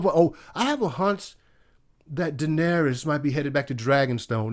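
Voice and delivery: soft, hushed mocking take on the show's logic; mock hunch voice. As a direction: murmur it like a smug plot excuse. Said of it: "there ain't no fucking hunches".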